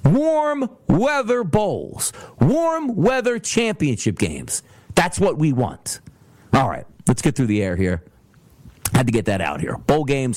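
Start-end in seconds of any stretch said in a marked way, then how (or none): 0.0-4.6 very slow, high, drawn-out words; slow emphatic demand. Real, not acted. Host pleading for warm-weather games, slow and drawn out. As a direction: savor each word like a wish. Stating what he wants.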